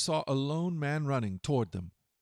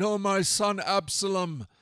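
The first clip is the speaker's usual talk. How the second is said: high, raised cry of grief, easing at the end; grieving father's cry; tearful lament; 5 semitones higher, 4 dB louder than usual. A narrator's reading. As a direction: wail it in mourning, without sobs. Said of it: "burst into tears".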